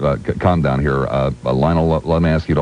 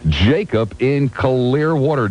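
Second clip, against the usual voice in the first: drawn-out, high-pitched announcement; big slow radio intro; showy caller introduction; 6 semitones higher, much slower than usual. Real, not acted. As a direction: stretch out the name like a ring announcer. Host grandly introduces next caller.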